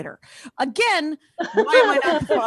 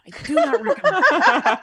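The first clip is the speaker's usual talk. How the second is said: laughing; 9 semitones higher, 5 dB louder than usual. Acted. Comic repeat of a warning.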